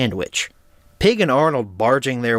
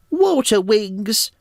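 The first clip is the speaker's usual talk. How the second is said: put-on Pig's accent, slow and high; 9 semitones higher, much slower than usual. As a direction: stretch out water in Pig's accent. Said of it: "fun to say in that accent".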